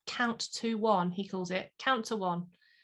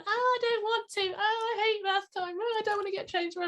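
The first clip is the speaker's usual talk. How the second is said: put-on whiny child; 11 semitones higher than usual. Child at bath time, whining high at the parent. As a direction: whine like a kid refusing the bath.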